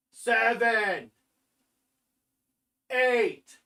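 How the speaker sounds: strained count on each rep; counting out push-ups. Real, not acted. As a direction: count out each push-up with effort, drawn out and falling. Counting push-ups while doing them.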